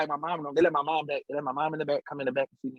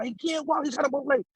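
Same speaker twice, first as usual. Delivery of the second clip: high-pitched mimicked protest; insistent, raised-pitch retelling; retold urgent protest; 9 semitones higher than usual. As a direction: plead over police orders, as imitation. Bystanders protest to police.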